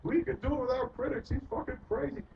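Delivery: high mocking whine of a sneering critic; high put-on voice sneering at him. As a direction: parody the critics in a high scornful voice. Mocking the critics who attacked.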